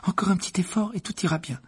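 high, quick reassurance; light, high, quick encouragement; encouraging girl. Acted. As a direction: hurry a sick friend along kindly. Hermione encourages sick Ron.

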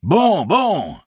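high, delighted farmer cry; excited exclamation. A narrator's reading. Farmer hears the uprising has begun, crying out. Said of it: "s'écria le fermier Casbonne".

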